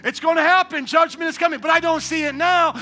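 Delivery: fiery preaching. Acted. Pastor warns sinners of coming wrath, fiery preaching. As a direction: thunder a warning from the pulpit.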